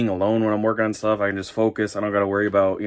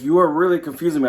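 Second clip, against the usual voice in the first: exasperated; 6 semitones higher, faster than usual. Retold or imitated. Exasperated reply to confusing texts.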